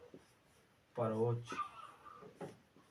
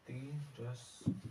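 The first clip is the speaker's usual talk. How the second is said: mild slow demo; 8 dB quieter, slower than usual. Shown as a demonstration. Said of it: "तिरस्कार का शुद्ध रूप होगा".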